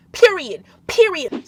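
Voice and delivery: loud, punchy, final word on it; emphatic period. Real, not acted. To viewers ending the religion rant, loud emphatic. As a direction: slam the point shut, loud.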